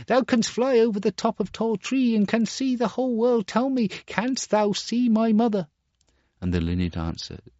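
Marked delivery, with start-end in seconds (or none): 0.1-5.7 much higher asking; pleading boy. Acted. Star Child asks the linnet for his mother, pleading. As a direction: beg the bird for news.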